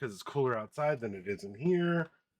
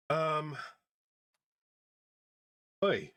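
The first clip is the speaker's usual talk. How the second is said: ordinary delivery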